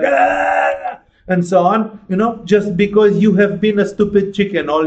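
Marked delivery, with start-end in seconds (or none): 0.0-1.0 a short low cry flung out, mocking pillow-beating rage; mock primal scream; mock therapy scream. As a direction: imitate a silly therapy yell, half-mocking. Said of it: "beat a pillow and go like".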